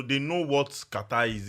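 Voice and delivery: ordinary talk, nothing marked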